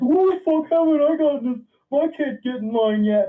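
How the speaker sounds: high, loud mock of an angry parent; mocking a cranky parent, pitched high and loud. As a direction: squawk it like an entitled parent.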